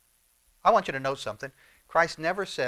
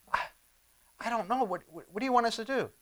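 higher, stammering, flustered reply; mock bewilderment; 7 semitones higher, 4 dB quieter, faster than usual. Preacher voices followers baffled by Jesus, flustered. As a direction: stumble over the words, at a loss.

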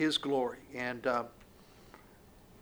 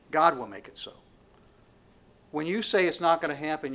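ordinary talk, nothing marked

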